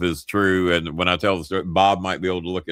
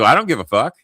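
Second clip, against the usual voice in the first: 1 dismissive; 6 semitones higher, 5 dB louder, faster than usual. Retold or imitated. Voicing the gruff booker.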